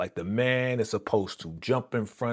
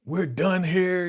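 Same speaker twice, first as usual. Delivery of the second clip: higher-pitched acted anger; indignant retold outburst; mad; 8 semitones higher, 3 dB louder than usual. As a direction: imitate someone angrily calling it off. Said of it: "He's mad at your boy".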